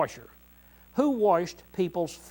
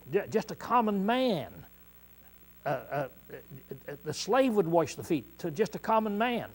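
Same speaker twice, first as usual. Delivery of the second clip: ordinary talk, nothing marked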